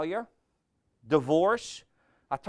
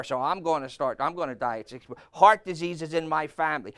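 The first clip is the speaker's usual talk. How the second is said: ordinary talk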